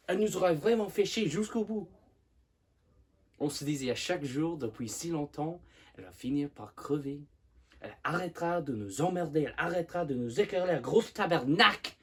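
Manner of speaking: fast, loud, frustrated tirade building to a shout; rage and frustration. Acted. Simone vents years of resentment at his mother, enraged and frustrated. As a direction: rush through the grievances, then explode on the final curse. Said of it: "exprime sa rage et sa frustration".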